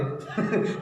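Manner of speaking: breathy chuckle; a quick amused laugh mid-sermon; short laugh. Real, not acted. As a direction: laugh at your own joke. Laughing at his own example.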